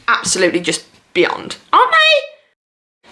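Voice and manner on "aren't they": stretched, much higher sing-song; gushing question. Real, not acted. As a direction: drag out the question, swooning. Rhetorical gush to viewers.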